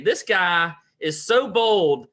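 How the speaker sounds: głośno i wysoko, z niedowierzaniem; incredulous rant. Retold or imitated. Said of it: "and I'm thinking".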